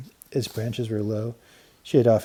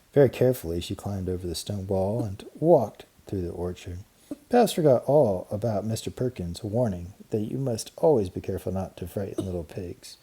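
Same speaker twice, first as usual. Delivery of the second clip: ordinary delivery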